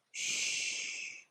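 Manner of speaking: soft unvoiced whisper; breathy secret whisper. Shown as a demonstration. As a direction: hush it like a secret. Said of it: "So you get to whisper it".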